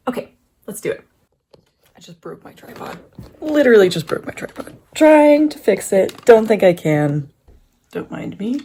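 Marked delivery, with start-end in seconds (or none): 1.9-3.0 quiet, deflated disbelief; stunned mutter. Real, not acted. Tripod mishap, first stunned report. 3.4-4.7 raised voice, pitched up at the start; loud, falling cry of frustration; loud exasperation. Real, not acted. Tripod mishap, repeated in exasperation. 4.9-7.3 calling out while struggling with a repair; loud frustration. Real, not acted. Calling out while fixing tripod.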